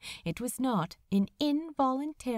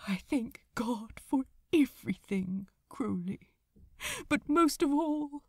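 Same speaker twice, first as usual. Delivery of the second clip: tearful whisper. Acted. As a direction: let the voice break into a whisper. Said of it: "His voice broke as his tears bled".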